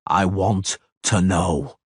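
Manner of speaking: slow, deliberate words; slowly. Acted. Geralt pressing Istredd for answers, slow and deliberate. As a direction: weigh each word. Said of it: "Geralt said slowly".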